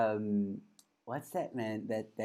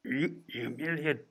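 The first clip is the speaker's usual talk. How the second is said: soft, faltering impression attempt; villain voice slipping into puppet sage; hesitant, muffled character voice; botched villain voice; 5 dB quieter than usual. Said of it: "Now I'm going Yoda".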